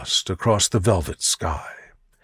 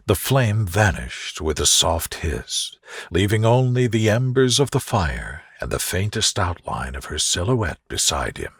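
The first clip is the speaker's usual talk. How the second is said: plain reading voice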